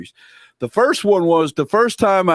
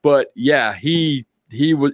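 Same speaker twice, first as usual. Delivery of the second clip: usual speaking voice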